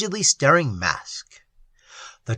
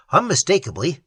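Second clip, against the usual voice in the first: ordinary delivery, nothing marked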